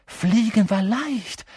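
high, slow, loving discovery; exhilarated narration; elated. A narrator's reading. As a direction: stretch it out with soaring joy. Wild joy of first flight.

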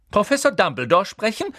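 high, loud incredulous echo; sharp, distrustful question; suspicious. A narrator's reading. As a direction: repeat it as if something is fishy. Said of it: "als ob daran etwas faul wäre".

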